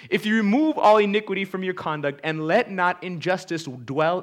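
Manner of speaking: loud, measured preaching read; scripture reading. Acted. Preacher reads Zophar's promises to Job, loud proclamation. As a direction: declaim each promise to the congregation.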